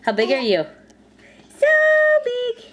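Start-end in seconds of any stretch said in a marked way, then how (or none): 1.6-2.6 high cheer. Real, not acted. Cheering baby's size game.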